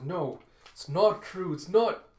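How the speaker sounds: high, loud, anguished denial; furious and upset. Acted. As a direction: cry out in hurt anger, drawn out and rising. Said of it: "getting furious and sad".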